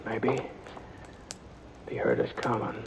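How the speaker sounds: tense near-whisper in a dark cave; hushed. Acted. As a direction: keep it hushed so the outlaw can't hear. Sneaking up on hidden outlaw.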